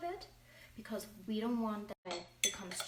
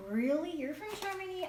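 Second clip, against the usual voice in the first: mimicked astonishment, pitched much higher; surprised disbelief; 9 semitones higher, 4 dB louder than usual. People reacting to the speaker being from Germany, surprised. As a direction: quote them in high-pitched disbelief.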